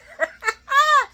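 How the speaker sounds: loud, high burst of laughter; real delighted laugh. Real, not acted. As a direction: let out a big high cackle. Laughs at Ned not being Eddie.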